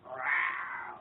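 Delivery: low, breathy mock monster growl; a playful child's monster growl. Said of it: "she kinda growled at it".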